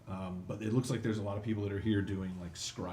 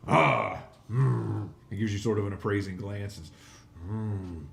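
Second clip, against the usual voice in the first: louder, appraising hobgoblin voice; hobgoblin boss; 8 dB louder than usual. Acted. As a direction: size them up like a greedy boss.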